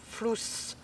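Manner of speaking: "flous" repeated softly with a voiceless S ending; quiet trial "flous" with a long final S; corrected final S. Shown as a demonstration. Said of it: "Pas Z, flous".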